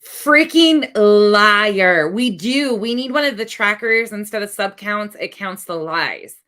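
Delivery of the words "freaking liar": exasperated. Real, not acted. Reacting to clip.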